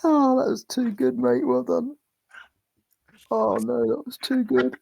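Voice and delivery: high, loud gushing through laughter; delighted cry-laugh. Real, not acted. Praising while laughing hard.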